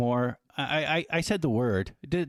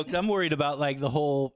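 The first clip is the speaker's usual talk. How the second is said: ordinary talk, nothing marked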